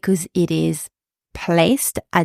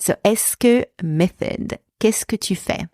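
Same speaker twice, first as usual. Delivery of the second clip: normal talking voice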